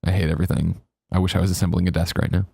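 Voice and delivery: low, bored monotone; a hint of a bored deadpan coworker; Kevin. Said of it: "Kevin always sounds like this".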